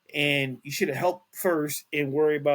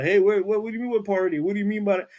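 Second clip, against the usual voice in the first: mock baffled, higher and louder; hammed-up puzzled questioning; imitating a confused guy; 6 semitones higher, 5 dB louder, faster than usual. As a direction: play the dazed man answering, pitched up. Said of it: "talking to him".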